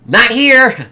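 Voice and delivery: high, loud mimicked answer; mock yelled reply; shouted kid reply. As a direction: call it back like a kid across a room. Acting out the kid's reply.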